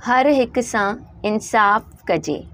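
slow dictation; slow reading of the line. Shown as a demonstration. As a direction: read हर हिक सां इंसाफ़ कजे slowly, word by word. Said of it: "नीचे जो हमारे पास line है".

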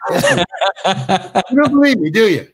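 slow and drawn out, laughing through it; amused, slow mock-director delivery; slow laughing repeat. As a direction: savour the director's line, stretching it out with a laugh. Speaker repeats director's line, savouring it.

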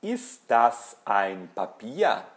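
slow, word-by-word German; careful teacher's question awaiting an answer; drill question. Shown as a demonstration. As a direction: ask slowly, swooping up then down on "Papier". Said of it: "eu pergunto e você responde".

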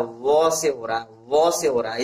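वो said low, opening with a w glide; low-pitched वो, consonant first; w-sound shown. Shown as a demonstration. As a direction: drop the pitch and sound वो as "wuh". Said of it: "इसका उच्चारण किससे हो रहा है".

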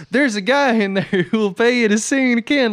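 high, delighted rube with a laugh breaking in; excited Delmar. Acted. Podcaster quoting Delmar's glee at paid singing, excited. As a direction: pitch it up, thrilled by easy money. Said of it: "they're just so, like, excited".

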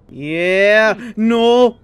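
normal talking voice

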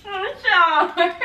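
squealing "sour" through laughter; laughing at the sour taste. Real, not acted. Biting the sour yuzu candy.

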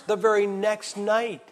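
ordinary talk

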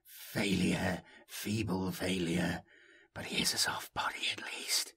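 low, hushed malice, sinking to a whisper; soft sinister echo. A narrator's reading. Unheard echo haunting the voice, soft and sinister. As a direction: sneer softly, ending in a whisper. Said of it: "soft, sinister echo".

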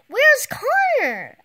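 high, loud, slow worried call; anxious call. Acted. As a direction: call out looking for him. Worried question in story.

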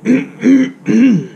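loud, grand throat clear. Acted. Grandpa getting attention before speaking, loud throat clear. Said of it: "cleared his throat".

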